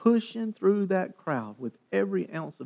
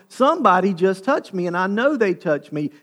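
loud, higher-pitched insistence; raised voice, refusing to be hurried; insistent; 5 semitones higher, 10 dB louder than usual. Acted. Jesus interrupting Jairus about being touched, insistent. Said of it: "but Jesus is going".

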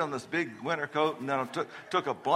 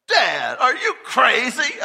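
put-on loud high teen shout; daughter yelling; 9 semitones higher, 11 dB louder, slower than usual. Teen daughter yelling at her dad, shocked shout. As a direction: yell it in disbelief as the daughter. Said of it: "She says".

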